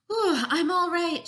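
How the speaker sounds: loud, high-pitched burst of reassurance; relieved exclamation. A narrator's reading. Rescued Jessica tells her friends she's all right, exclaiming. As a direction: pop up high and loud with a big "ooh".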